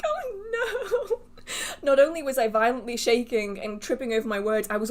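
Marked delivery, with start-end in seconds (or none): 0.0-1.2 high, laughing "oh no"; much higher, half laugh, half groan; laughing dismay. Real, not acted. Hearing she was blotchy.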